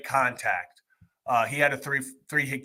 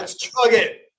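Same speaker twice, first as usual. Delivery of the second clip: excited; 9 semitones higher, 6 dB louder, slower than usual. Real, not acted. Urging co-host to chug juice.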